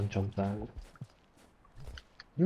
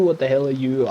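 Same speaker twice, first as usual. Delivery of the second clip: ordinary delivery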